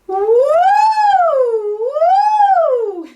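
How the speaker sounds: very high, loud siren woo. Shown as a demonstration. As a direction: pull a long siren up and forward. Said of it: "So using that siren sound".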